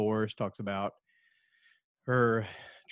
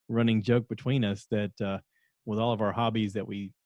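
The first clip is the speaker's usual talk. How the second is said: ordinary delivery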